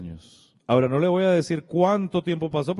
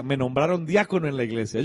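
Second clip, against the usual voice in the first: normal talking voice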